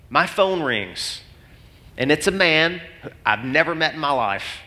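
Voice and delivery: ordinary talk, nothing marked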